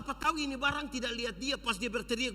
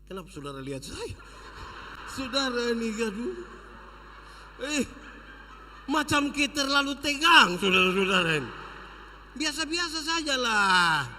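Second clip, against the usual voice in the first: teasing aside; 2 semitones higher, 6 dB louder than usual. Real, not acted. Teasing tense congregation.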